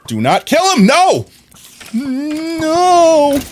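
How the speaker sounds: high, loud anguished yell; desperate shout. Real, not acted. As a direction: scream to stop them. Begs others not to kill.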